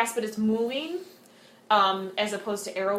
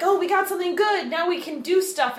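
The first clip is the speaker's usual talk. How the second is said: smug excited; 9 semitones higher, 4 dB louder than usual. Retold or imitated. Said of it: "they're like".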